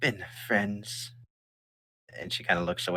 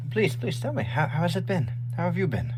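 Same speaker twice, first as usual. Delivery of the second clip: higher, delighted mother voice; lightly pitched-up happy mom; eager mother; 8 semitones higher than usual. Acted. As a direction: greet your returning child with joy. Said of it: "She goes".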